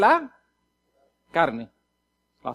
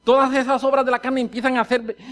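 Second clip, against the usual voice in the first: normal talking voice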